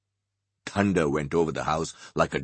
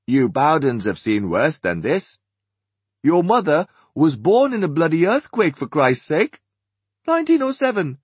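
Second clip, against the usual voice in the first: put-on higher, lively older Englishman; cheery bloke voice, pitched up; Archie, raised voice; 10 semitones higher, 6 dB louder than usual. Archie continues.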